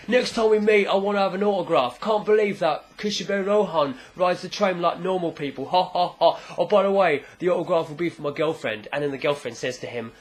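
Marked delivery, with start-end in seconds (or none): 0.1-8.9 hammed-up, boisterous tough-guy fan; brash fan. Tough guy demanding an autograph from his idol, brash mock voice. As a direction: play a cocky fan with a forced laugh.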